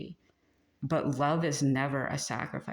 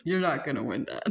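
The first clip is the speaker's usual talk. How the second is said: laughing; 2 semitones higher than usual. Retold or imitated. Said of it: "And I'm like".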